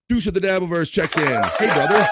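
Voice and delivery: loud, high welcome shout; hyped announcement. Real, not acted. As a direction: call out the viewer's arrival with gusto. Hypes a viewer checking in.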